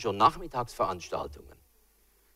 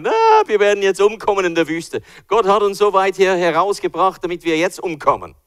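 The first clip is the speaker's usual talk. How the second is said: higher, louder whining lament; complaining Israelites; 9 semitones higher, 10 dB louder than usual. Retold or imitated. Israelites complaining to Moses in the desert, loud whining. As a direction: wail that you'll die in the desert. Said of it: "Und sie sagen".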